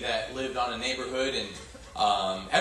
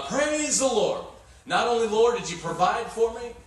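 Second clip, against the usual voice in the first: triumphant praise; 7 semitones higher, 3 dB louder than usual. Retold or imitated. Old lady answers the atheist, high and exultant. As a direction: exult with joy.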